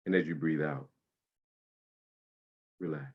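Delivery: guided meditation. Acted. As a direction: ease them into calm breathing. Guides body-scan meditation.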